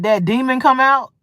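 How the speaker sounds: ordinary talk, nothing marked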